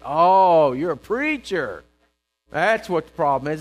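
loud, drawn-out accusing retort; mock indignation. Homeless listener rounds on the visiting preacher, loudly indignant. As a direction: act out a loud, scornful discovery. Retold objection to finding a preacher.

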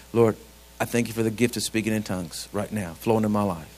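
low-pitched, earnest praying; private prayer. Shown as a demonstration. Models a private quiet-time prayer.

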